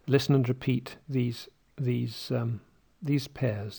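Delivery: normal talking voice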